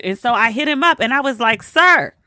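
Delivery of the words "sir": retold reproach, pitched high and loud; exasperated call-out. Woman confronting her cousin over his video, exasperated. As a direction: pull him up sharply on one word.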